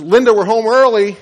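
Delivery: mom calling upstairs, loud and high. Acted. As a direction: holler a sing-out greeting through the house. Mom calling up on arriving home.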